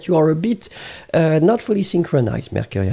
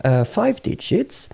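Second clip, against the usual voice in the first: usual speaking voice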